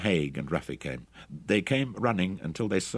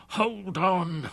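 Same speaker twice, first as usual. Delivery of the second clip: tiny inner voice; 13 semitones higher than usual. A narrator's reading. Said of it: "a tiny voice screamed in his roaring head".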